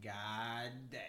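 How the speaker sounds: low, hushed resignation; real quiet. Retold or imitated. Driver realizing trouble is coming, low and quiet. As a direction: mutter it softly, as if to yourself. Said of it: "everybody just get real quiet".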